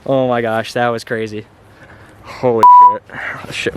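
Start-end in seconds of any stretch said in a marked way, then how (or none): 2.2-3.0 loud startled swear; loud outburst. Real, not acted. Sudden outburst in the field. 3.1-3.7 whispered embarrassed swear. Real, not acted. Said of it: "My bad".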